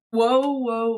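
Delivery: ordinary talk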